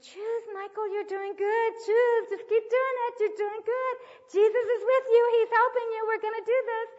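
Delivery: put-on rally the struggling child, full voice; emotional high-pitched urging; loud eager encouragement. Urging crying boy in church.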